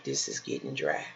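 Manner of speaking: normal talking voice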